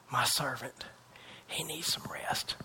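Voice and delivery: soft whispered voice of God; tender whisper. Acted. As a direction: whisper it like a caring father.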